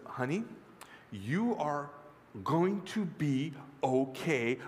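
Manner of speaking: slow, gentle, spaced-out words; reassuring mother. Acted. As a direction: soothe a scared child patiently. Mother reassures her scared child.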